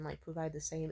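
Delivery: ordinary delivery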